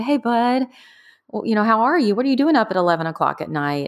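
gentle mom voice. Retold or imitated. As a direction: speak to him like a tender mom. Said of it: "And I said".

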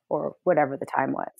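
usual speaking voice

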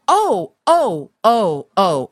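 retold excited realization. Sudden realization, retold reaction.

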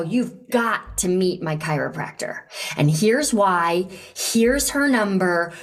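emphatic urging of a friend; keen, commanding personal recommendation; passionate, insistent. Shown as a demonstration. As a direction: insist they go, with total conviction. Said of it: "don't you deliver it more in that way".

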